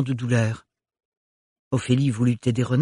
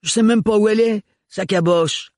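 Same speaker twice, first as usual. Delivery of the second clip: high, loud frustrated shout; angry high yell; roaring; 8 semitones higher, 4 dB louder than usual. Acted. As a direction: keep yelling while flailing. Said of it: "rugit Renard".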